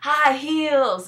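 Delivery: put-on high, slow girly coaxing; lightly voiced friend. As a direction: coax like a playful girlfriend. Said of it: "Devin was funny when she was like".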